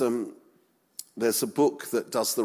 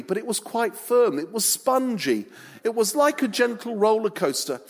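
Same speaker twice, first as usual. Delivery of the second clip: lightly voiced Peter, chatty and proud, pitched up; a hint of a bragging fisherman retelling his walk; as Peter, boastful; 9 semitones higher, 4 dB louder than usual. Preacher playing Peter to the congregation, lightly pitched up.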